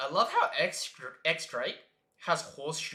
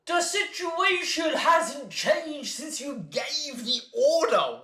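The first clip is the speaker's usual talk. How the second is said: slow, high, loud shout; a drawn-out angry shout; shouted line; 11 semitones higher, 5 dB louder, slower than usual. Acted. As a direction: bellow it out slowly. Reads a shouted manga line.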